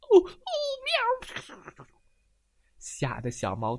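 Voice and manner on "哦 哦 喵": very high, loud alarmed meow; cat shrieks in alarm; startled cat yowl. Acted. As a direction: jump with fright and yowl.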